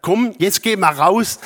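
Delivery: lively "let's go" shout, retold; excited call to a dog. Owner calls the waiting dog.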